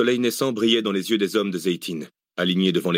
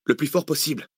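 higher-pitched, mildly pressing; insistent; 6 semitones higher than usual. A narrator's reading. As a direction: press the point. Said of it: "insista Zach".

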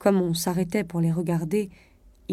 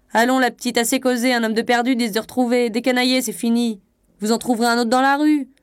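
higher, loud, coarse brush-off; rude conductor; 4 semitones higher, 4 dB louder than usual. A narrator's reading. Conductor telling the lost wife to clear off, rude. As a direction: dismiss her rudely in a raised voice. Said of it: "Le conducteur devenait grossier".

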